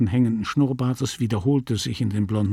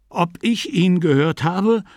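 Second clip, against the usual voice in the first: raised pitch, mildly indignant retort; 7 semitones higher, 3 dB louder than usual. A narrator's reading. Sick man answering his friend's question, indignant. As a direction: bristle at being doubted. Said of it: "sagte der Kranke aufgebracht".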